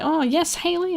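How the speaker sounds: high, sing-song teacher; a prim teacher calling on a kid; sweet teacher voice. Retold or imitated. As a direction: mimic a sugary teacher. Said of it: "she was like".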